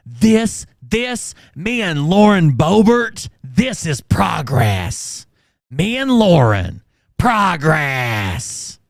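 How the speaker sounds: high, loud mock proclamation with drawn-out words; mocking imitation. Host imitating the man in the clip, loud high mockery. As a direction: blare it out as a hammed-up imitation. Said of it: "He's like".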